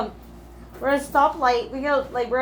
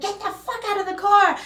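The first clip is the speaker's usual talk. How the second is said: higher-pitched retelling of mom's outburst; imitated angry order; 6 semitones higher than usual. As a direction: act out mom ordering someone out. Quoting mother's angry order.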